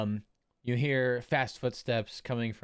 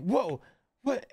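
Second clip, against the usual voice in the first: put-on amazed kid; 12 semitones higher than usual. Kid amazed at the shape change.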